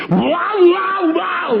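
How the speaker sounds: very high wow ending in a shout; mock stoned kid. Comedian mimics his green-faced son coming home, very high wow. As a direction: gasp wow like a dazed teenager. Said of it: "he went".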